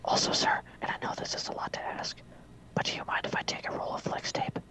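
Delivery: whispered assistant's request. Acted. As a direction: ask it in a whisper.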